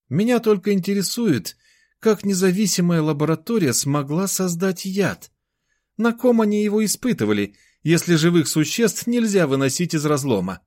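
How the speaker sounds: high-pitched character voice, pondering; thoughtful. A narrator's reading. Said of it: "задумчиво проговорил Ефим Прохорович". (задумчиво, высоким голосом)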